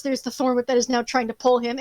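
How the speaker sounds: normal talking voice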